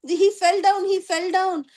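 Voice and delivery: high, light mock shout; mock crowd shouting. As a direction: imitate a crowd calling out. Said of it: "everyone started shouting".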